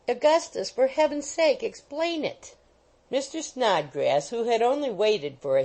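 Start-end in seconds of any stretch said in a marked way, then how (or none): 0.1-2.5 high, urgent plea; pleading young woman. A narrator's reading. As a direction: beg him to explain. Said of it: "Emily pleads with her lover".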